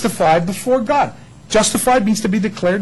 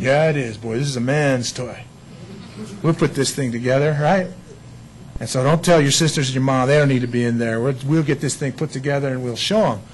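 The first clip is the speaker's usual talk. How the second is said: lower, softer know-it-all dad voice; proud macho dad; 9 semitones lower, 5 dB quieter than usual. Retold or imitated. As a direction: puff up like a dad showing off to his sons. Dad boasting to his boys.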